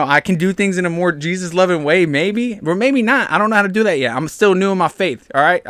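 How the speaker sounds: normal talking voice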